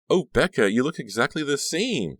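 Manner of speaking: put-on high, loud girlish outburst; excited cry. A narrator's reading. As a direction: cry out in delighted recognition. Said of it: "the girl cried".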